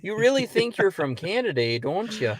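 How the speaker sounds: mock Canadian-sounding northern accent; put-on Yooper accent. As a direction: tease in a hammed-up Yooper accent. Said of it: "that's an accent".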